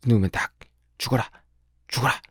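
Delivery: high-pitched, spiteful cursing in short bursts; scolding a hen. Acted. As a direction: curse at the hen between blows. Girl beating a hen, voiced.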